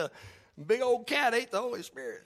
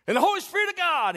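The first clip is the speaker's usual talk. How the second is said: high, loud preaching yell; preacher's yell; 11 semitones higher, 5 dB louder than usual. Retold or imitated. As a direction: belt it out like a preacher announcing the dove. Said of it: "and he yells it out".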